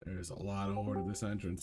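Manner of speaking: ordinary talk, nothing marked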